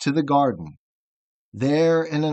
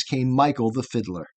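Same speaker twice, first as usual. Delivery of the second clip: usual speaking voice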